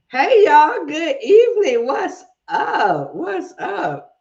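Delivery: high, sing-out greeting in a country drawl. Real, not acted. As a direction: holler hello to the crowd in a Southern country drawl. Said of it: "country drawl going on".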